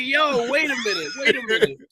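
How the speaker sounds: very high, laughing mock alarm; acted-out bafflement. Co-host replays his reaction to the prank, acted-out bafflement. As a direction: replay your confusion in a squeaky pitch.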